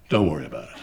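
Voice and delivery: very low, quiet brush-off; dismissive. Acted. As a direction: dismiss it from deep down. Official dodging a question.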